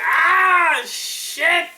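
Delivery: high, loud drawn-out howl; mock pain cry. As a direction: yell as if hit in the knees. Acts being hit across knees.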